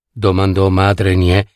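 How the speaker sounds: ordinary delivery, nothing marked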